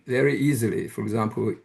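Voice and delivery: ordinary talk, nothing marked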